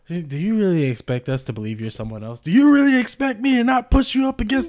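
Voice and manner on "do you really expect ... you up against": loud, high hammed-up threat; high loud mock threat. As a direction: ham up a tough-guy threat, high and loud. Quoting show line loud.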